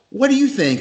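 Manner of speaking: high imitation of a musician asking; Ace. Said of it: "He goes".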